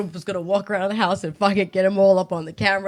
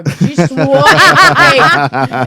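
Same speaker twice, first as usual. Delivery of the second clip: higher, much louder, through laughter; laughing; 6 semitones higher, 11 dB louder, much slower than usual. Real, not acted. Co-host telling how she got sworn at, laughing loudly. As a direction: blurt it out laughing, drawn out.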